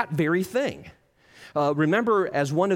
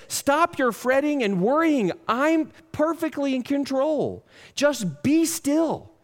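higher, earnest voice of God; God reassuring; 9 semitones higher, slower than usual. Acted. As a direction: lift the pitch and slow down on the last words. God's words paraphrased.

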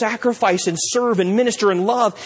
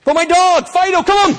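loud, high pleading about the dog; whiny protest; 11 semitones higher, 6 dB louder than usual. Pretend. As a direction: beg to go back for the pet. Resident protesting over his dog.

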